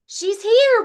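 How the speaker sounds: high, loud hopeful shout; excited call. Acted. Rabbit calling out that Hermit Crab is found, high and loud. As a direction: call out thrilled. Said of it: "Rabbit calls".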